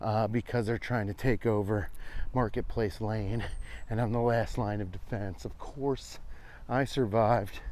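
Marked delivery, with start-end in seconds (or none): none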